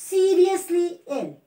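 "seriously" stretched out slowly and pitched high, "ill" falling away; slow model read with "seriously" high, trailing into "ill"; stress on seriously. Shown as a demonstration. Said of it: "nucleus है वो क्या है".

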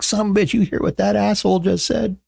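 very high, put-on voice; retold wisecrack. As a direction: say it high on one held pitch. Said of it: "I'm like".

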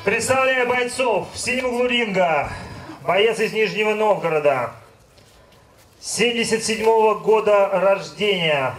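ordinary delivery

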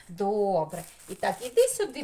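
ordinary delivery

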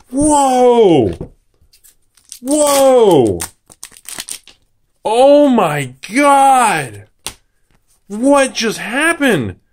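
high, long drawn-out exclamations; awestruck. Real, not acted. Opener stunned by the box, slow high awestruck cries. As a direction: stretch each word out in real amazement.

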